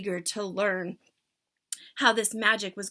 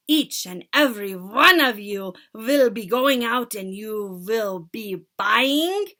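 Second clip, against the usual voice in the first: loud, drawn-out witch command; 2 semitones higher, 10 dB louder, slower than usual. Acted. Witch leader building suspense for her followers, slow. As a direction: stretch it out, teasing. Grand High Witch speaking.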